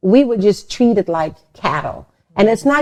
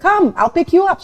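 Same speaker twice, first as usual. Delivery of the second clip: bright, raised voice of a welcoming friend; quoted friend; 10 semitones higher, 5 dB louder, faster than usual. Retold or imitated. Retelling how her friend offered to pick her up, voice raised. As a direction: call it out high and eager.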